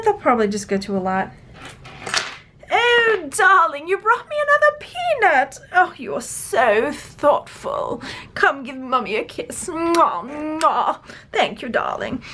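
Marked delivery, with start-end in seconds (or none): 2.7-12.2 put-on high, gushing royal English accent; imitated queen. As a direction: coo grandly like a royal mummy. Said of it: "trying very poorly to imitate your, your".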